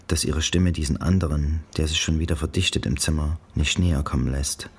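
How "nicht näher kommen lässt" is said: plain reading voice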